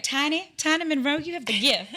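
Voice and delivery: playful Southern auntie bestowing a blessing; Southern aunt accent. Retold or imitated. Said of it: "she's Southern".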